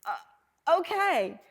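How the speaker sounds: high, drawn-out stunned "uh, okay"; flustered okay. Retold or imitated. To Steve Harvey when he suddenly agrees to the interview, a high flustered okay. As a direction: caught off guard and trying to go along with it.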